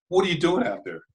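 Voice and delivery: toned-down retelling of a yell; retold yelling. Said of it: "He starts yelling at me".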